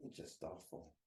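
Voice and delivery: a soft whisper, repeating it to himself; whispered afterthought of pain; whispered echo. Real, not acted. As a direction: let it fall away to a whisper. Pained memory of school bullying.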